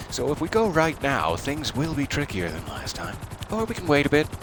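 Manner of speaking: normal talking voice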